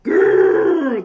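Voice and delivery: loud growling roar; lion growl. Acted. Lion pounces on monkey.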